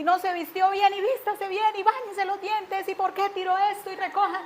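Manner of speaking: mock nagging, one order after another; imitating nagging yelling mother. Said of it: "Y gritaba y gritaba todo el tiempo".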